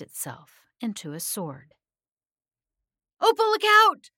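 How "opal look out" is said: high, piercing scream of alarm; screamed warning. A narrator's reading. Sammy warning a friend of the sword, screaming. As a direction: scream out a sudden urgent warning. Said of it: "Sammy screamed".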